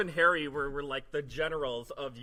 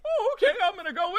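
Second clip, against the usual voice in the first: squeaky Harry voice; 5 dB louder, faster than usual. Retold or imitated. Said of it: "And he's like".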